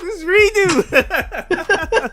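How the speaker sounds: high, loud and laughing; laughing exclamation. Real, not acted. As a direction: crack up while shouting it.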